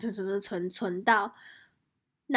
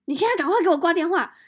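higher, louder and fast command; urgent order; 8 semitones higher, 7 dB louder, faster than usual. Retold or imitated. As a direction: boss urgently telling her to hang up. Supervisor orders hang up. (急促大声命令)

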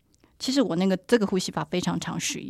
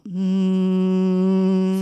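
long steady buzzing hum; bee voice; 6 dB louder than usual. Shown as a demonstration. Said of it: "发出像蜂鸣一样". (持续的蜂鸣声)

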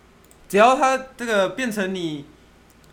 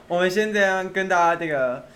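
ordinary talk

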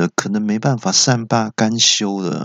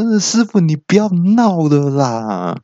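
high-pitched amused pleading; said laughing, lightly; 7 semitones higher than usual. Acted. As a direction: tell him off playfully in a higher, drawn-out voice. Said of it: "我也笑着说".

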